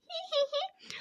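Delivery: high, soft hee-hee; soft chuckle. A narrator's reading. Robot amused at guest's love of his cooking, soft chuckle. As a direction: titter softly in a high voice. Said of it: "and chuckles softly".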